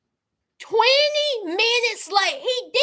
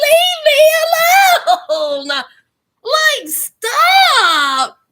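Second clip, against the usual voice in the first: loud, high, stretched-out squeal; hammed-up giddy shriek through laughter; swooning mock protest; 6 semitones higher, 9 dB louder, much slower than usual. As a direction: protest while loving every second.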